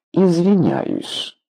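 grudging apology, high and drawn out; буркнула. A narrator's reading. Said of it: "буркнула она". (буркнуть извинение нехотя, протяжно)

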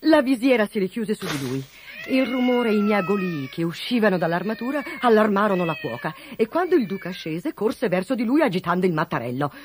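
plain reading voice

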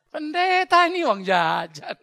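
slow words broken up by laughter; laughing. Real, not acted. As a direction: laugh through it in disbelief. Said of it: "laughing at the story". (tertawa geli sambil bicara)

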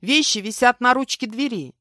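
high, loud call through a door; shouting. A narrator's reading. Host calling to guest in the bath, shouting. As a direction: call out so he hears in the bathroom. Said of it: "я крикнула".

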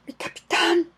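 high, strained cry of relief; choked cry. Acted. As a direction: cry out his captain's name, choking up. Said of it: "gritando con voz ahogada".

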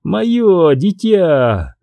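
high, slow and proud. Acted. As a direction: savor the words with pride. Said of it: "гордо повторяет она".

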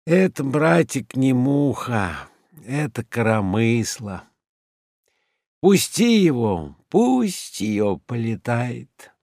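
unhurried, lazy and indulgent. Acted. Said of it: "говорит лениво".

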